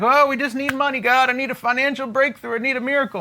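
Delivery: imitated offhand plea; mock flippant pleading. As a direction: rattle it off as a glib, whiny mock prayer. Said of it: "stop being so flippant about just like".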